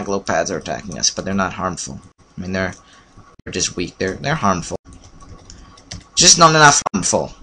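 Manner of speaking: normal talking voice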